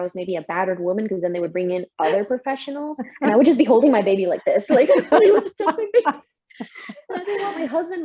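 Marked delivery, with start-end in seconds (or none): 4.7-8.0 laughing while telling it, pitch jumping up; a real laugh breaking into the words. Real, not acted. A mother laughing at her own overprotectiveness, pitched high.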